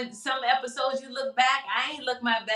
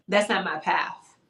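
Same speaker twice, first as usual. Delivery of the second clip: ordinary talk, nothing marked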